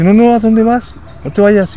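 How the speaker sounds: high, loud mock call after him; calling him back. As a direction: shout him back before he leaves. Staffer calls him back, retold.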